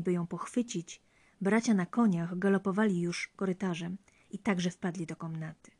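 plain reading voice

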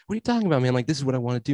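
high-pitched incredulous objection; retold baffled protest, starting high; acted protest. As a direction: push back in mock disbelief, voice jumping up. Acts out his protest to friend.

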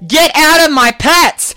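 preaching at full force. Acted. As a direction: preach it to the congregation, building the fire. Preaching at the congregation.

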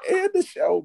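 high, laughing plea. Real, not acted. Laughing hard, repeats line.

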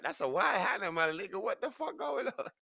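very high, loud squealing holler; hammed-up freak-out at top pitch; panicked disbelief. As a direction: yell it in wild alarm. Acted panic at the sight.

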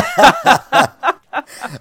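a squealing high-pitched laugh; high burst of laughter. Real, not acted. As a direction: crack up helplessly.

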